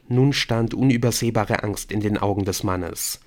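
plain reading voice

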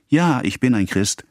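a hint of a schoolboy; clear-voiced boy. A narrator's reading. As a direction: answer like a boy reciting catechism. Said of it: "die hellen Antworten der Knaben".

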